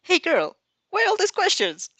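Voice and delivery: high, loud, cheeky little creature voice; cheeky chirp. Acted. Dark Shadow teasing the girl, cheeky high chirp. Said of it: "cheekily chirping".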